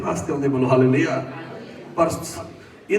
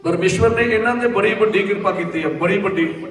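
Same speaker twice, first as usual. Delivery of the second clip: ordinary delivery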